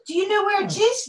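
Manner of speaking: high sing-song voice to the dog; pet talk. Real, not acted. Talking to her dog.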